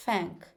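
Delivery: "thank" with a hissed, F-like TH, no vibration; unvoiced TH. Shown as a demonstration. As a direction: start "thank" voiceless, tongue between the teeth. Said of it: "que traz o som chiado, fazendo parecer a letra F".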